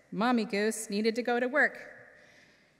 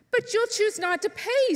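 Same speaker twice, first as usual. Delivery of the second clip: much higher, louder fox voice in protest; the fox objecting, pitched way up; Mister Fox; 12 semitones higher, 5 dB louder than usual. A narrator's reading. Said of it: "said Mister Fox".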